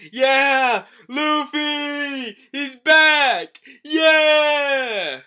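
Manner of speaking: high, loud excited crowd cheer; cheering fans. Pretend. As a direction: shout it out like thrilled fans. Cheering fans see Luffy back.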